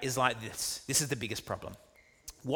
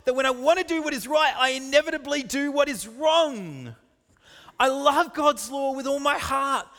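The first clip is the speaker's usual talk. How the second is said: high, raised, impassioned pulpit voice; forceful preaching; 8 semitones higher, 4 dB louder than usual. Acted. Preacher declaiming Paul's agony to congregation, forceful preaching. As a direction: drive it home at full preaching pitch.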